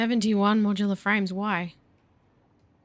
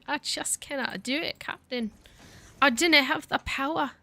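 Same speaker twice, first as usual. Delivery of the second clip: higher-pitched, badly faked Scottish accent; terrible Scottish accent; 8 semitones higher than usual. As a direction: put on a hammy Scottish engineer's accent. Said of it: "my terrible Scottish accent".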